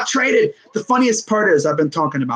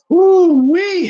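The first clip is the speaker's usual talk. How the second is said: high, loud drawn-out "woo-wee"; amazed whoop; 11 semitones higher, 6 dB louder than usual. Real, not acted. Streamer reacting to a monster trade, amazed whoop. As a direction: let out an impressed whoop, pitched way up.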